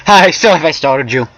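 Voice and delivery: high-pitched friendly question; character dialogue. A narrator's reading. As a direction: pitch it up and call it out brightly.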